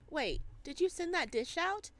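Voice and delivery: ordinary talk, nothing marked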